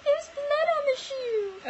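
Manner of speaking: high, slow squeaky bird. Retold or imitated. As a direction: play the tattling little bird. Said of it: "the bird was like".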